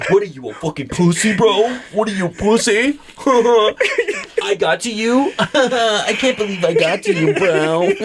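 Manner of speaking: laughing, gloating taunt; mock taunting. Imitating a coworker gloating over him, laughing taunt. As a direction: rub it in while laughing. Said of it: "I'll just look at her and say".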